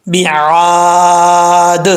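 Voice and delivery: "बिआद" with very long aa and echoing final d; sustained chant of "बिआद", d rebounding; qalqala stop. Shown as a demonstration. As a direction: hold the vowel in "बिआद", then bounce the d. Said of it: "कैसे पढ़ेंगे?".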